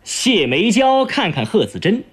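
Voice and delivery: usual speaking voice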